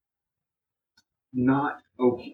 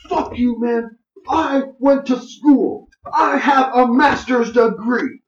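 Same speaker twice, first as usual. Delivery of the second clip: imitating an old man screaming, much higher and louder, slow and tearful; retold furious yell; 12 semitones higher, 9 dB louder, slower than usual. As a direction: yell it like he's blowing up at you, voice cracking. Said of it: "he's just getting mad".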